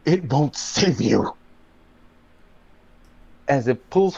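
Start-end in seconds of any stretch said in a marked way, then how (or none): 0.1-1.4 slow, ominous character voice; ringing voice. Acted. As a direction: let the warning ring out slowly. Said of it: "one's voice ring out".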